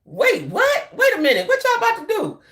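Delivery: reacting to a viral clip, in hammed-up surprise; mock double take. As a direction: catch yourself in amused disbelief. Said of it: "I was like".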